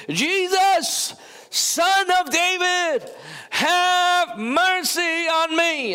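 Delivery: high, slow, pleading cry to Jesus; a blind beggar's high, slow cry for help. Retold or imitated. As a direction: call out high and drawn out for mercy. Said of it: "he began to cry out and say".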